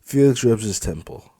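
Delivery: plain reading voice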